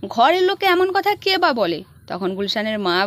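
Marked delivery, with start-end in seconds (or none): 0.1-1.8 angry. Retold or imitated. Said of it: "Gulshan রেগে গিয়ে বলে".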